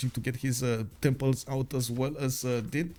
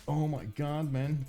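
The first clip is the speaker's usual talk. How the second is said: ordinary talk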